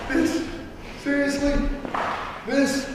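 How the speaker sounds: high incredulous scoffing at the opponent; pointing and sneering in disbelief; mock disbelief. Coach acting the heel mocking his opponent, high incredulous scoff.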